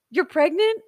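gasp, then a high, loud question; excited surprise. Retold or imitated. Partner learning of the pregnancy, gasping in excited surprise. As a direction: imitate a partner's thrilled disbelief. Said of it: "he got super excited".

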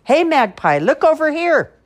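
high, loud shout at a bird. Acted. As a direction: yell to grab the magpie's attention. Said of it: "a loud shout".

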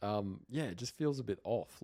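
ordinary delivery

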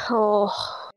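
ordinary delivery

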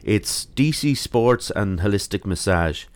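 normal talking voice

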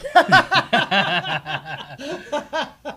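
loud, high belly laugh; burst of laughter. Real, not acted. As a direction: crack up helplessly and loudly. Cracks up at own joke.

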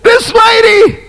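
high shouted plea; desperate cry. Acted. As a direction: call up to the king on the wall. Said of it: "She cried out".

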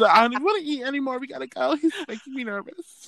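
high, fast flustered voice; mock panic. She panics over the cute guy, high and fast. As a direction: rush it out, half laughing and flustered. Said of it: "I said".

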